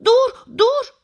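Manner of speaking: high, loud, short cries; alarmed rabbit yelling at top pitch; urgent shout. A narrator's reading. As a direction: shout to halt someone running off. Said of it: "diye bağırmış".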